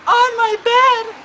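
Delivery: slow, high, loud mock wail; mock bawling. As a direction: drag it out like a bawling child. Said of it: "like, bawling".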